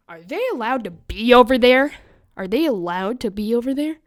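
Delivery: teacher protesting to the counselor, loud and outraged; indignant teacher. Retold or imitated. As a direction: demand it twice, indignantly.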